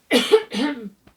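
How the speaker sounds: sharp hoarse cough; real cough from a sore throat; loud cough. Real, not acted. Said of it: "My voice is a little bit tattered at the moment".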